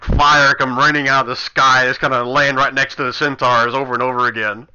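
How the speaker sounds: loud, amused game master narration; gleeful mock-epic narration. Game master describing the missed fire rays, gleeful mock-epic. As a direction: announce the spectacle loudly, teasing the player.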